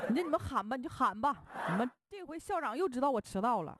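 a teacher wailing in mock exasperation; exasperated whine. Teacher despairs at loud class.

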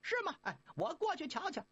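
high, quick monkey voice; high-pitched eager Monkey King. Acted. Monkey King asking brothers about master, high and quick.

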